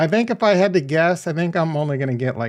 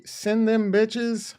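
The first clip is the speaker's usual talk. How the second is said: slow drawn-out; 5 semitones higher, 3 dB quieter, much slower than usual. Real, not acted. Superstitious send, dragged out.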